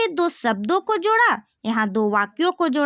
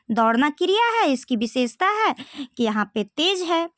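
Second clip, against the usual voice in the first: usual speaking voice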